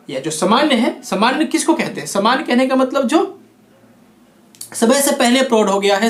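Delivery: usual speaking voice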